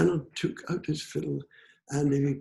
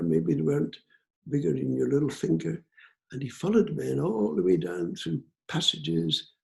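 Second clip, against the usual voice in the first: ordinary delivery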